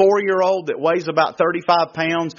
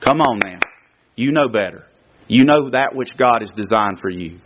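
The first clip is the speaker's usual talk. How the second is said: gentle inner voice; 7 semitones lower than usual. Pretend. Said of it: "Just gently saying".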